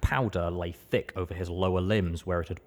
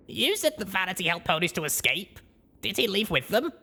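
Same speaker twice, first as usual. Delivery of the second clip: higher, rising surprised question; young mare voice; 11 semitones higher than usual. Acted. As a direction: Blackjack caught off guard. Blackjack asks the maid.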